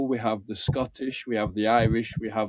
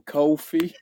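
imitated New York "coffee"; New York twang; 4 semitones higher, 3 dB louder than usual. Guest teasing Tim about his coffee, imitated New York twang. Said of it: "that, like, New York twang".

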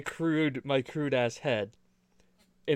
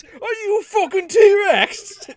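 very high, slow British yell; loud mock-British recap clip; bad British accent; 8 dB louder, slower than usual. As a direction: squawk it in cartoon English accent. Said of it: "last week on Tig Whippies".

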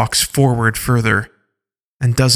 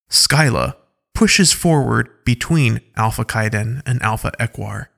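ordinary delivery, nothing marked

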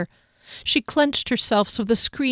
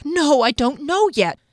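panicked; 7 semitones higher than usual. A narrator's reading. Panicked inner cry.

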